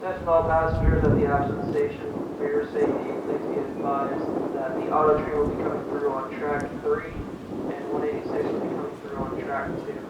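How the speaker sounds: station announcement. Real, not acted.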